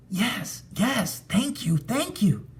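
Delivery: high, grateful ghost gushing thanks; a lonely spirit finally welcomed; overjoyed ghost. A narrator's reading. Ghost thanks the narrator, high and overjoyed. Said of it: "It looked so happy".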